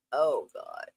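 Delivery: normal talking voice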